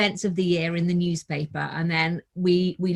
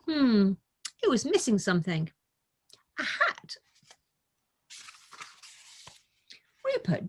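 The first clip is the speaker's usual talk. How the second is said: put-on gentle, higher storybook reading voice for children; story narration; 3 semitones higher than usual. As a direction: read it like a bedtime picture book, unhurried. Reads picture book aloud.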